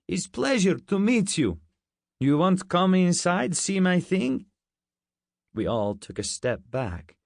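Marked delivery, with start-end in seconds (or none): 0.1-4.5 put-on high, eager Russian-accented invitation; thick Russian accent. A narrator's reading. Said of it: "He had a thick Russian accent".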